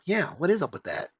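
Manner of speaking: wondering aloud, pitch lifted on the question; puzzled agreement. Acted. Imagined viewer agreeing with the reviewer, mildly puzzled. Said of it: "they kinda go".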